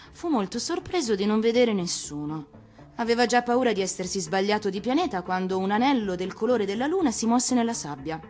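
ordinary delivery, nothing marked

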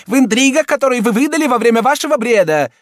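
high, loud impatient cardinal; angry impatience. A narrator's reading. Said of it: "с гневным нетерпением".

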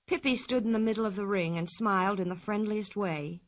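ordinary delivery, nothing marked

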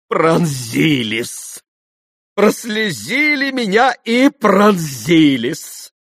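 high, loud, theatrical outpouring; high-pitched clowning sentimentality; effusive, buffoonish captain. A narrator's reading. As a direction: declaim with stretched, overwrought feeling.

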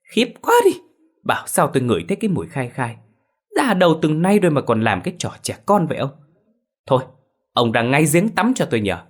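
a shrieking high cry of disgust, then scolding. Acted. Wife disgusted by her husband's smell, high scolding. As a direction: wrinkle your nose and scold him. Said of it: "Mụ Hường nhăn mặt".

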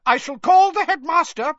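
high, loud stern shout. Acted. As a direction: shout a stern threat at him. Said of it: "shouted Professor McGonagall".

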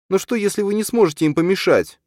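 put-on high, loud worried boy; boy fearing parents can't stop it; scared child voice. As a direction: ask like a frightened small boy. Frightened boy asks mother.

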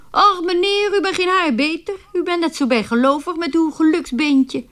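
calling out. Retold or imitated. Writer telling the superstitious gentleman off, high teasing call. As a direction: call out to him, cheekily exposing him. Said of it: "riep ik gewoon".

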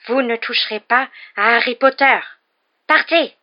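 high, loud, fierce command; fierce little creature shouting orders; ferocious elf. A narrator's reading. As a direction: drive him away ferociously. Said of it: "dit-il d'un ton féroce".

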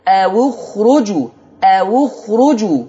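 slow أو اخرجوا with a rounded "awu"; clear damma on the waw. Shown as a demonstration. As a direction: stretch "awu-khruju" so the damma is heard. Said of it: "سامعين الضم؟". (أو اخرجوا ببطء بضم الواو)

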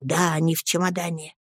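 soft, hushed confirmation; barely audible. A narrator's reading. Nanny confirming to the boy, barely audible murmur. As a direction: confirm it under your breath. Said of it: "еле слышно подтверждает Пиготи".

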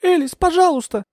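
very high, imploring cry; high, urgent entreaty; pleading. A narrator's reading. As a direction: implore her desperately. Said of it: "взмолилась я".

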